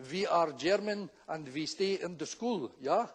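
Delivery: slow, stiff fake German accent; comic German accent, word by word. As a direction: put on a careful German accent. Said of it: "Oh, you're Germans".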